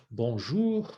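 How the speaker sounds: bonjour with a careful rounded "ou"; French teacher's model of bonjour. Shown as a demonstration. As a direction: shape an O on the end of bonjour. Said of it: "so O U".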